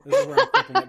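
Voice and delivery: high, loud, laughing through the words. Real, not acted. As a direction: crack up while announcing it. Cracking up over the clip.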